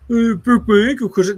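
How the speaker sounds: high, loud mocking whine; mock whiny voice. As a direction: parody a whining crybaby. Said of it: "you sound like a whiny little bitch".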